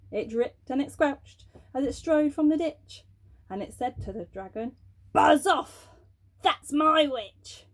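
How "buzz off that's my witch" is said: loud slow growled threat; fierce beast. Acted. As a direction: bark it at the dragon. Beast scares off dragon.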